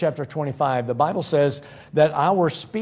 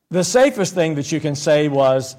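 ordinary talk

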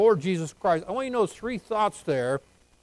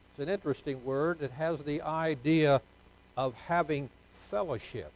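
normal talking voice

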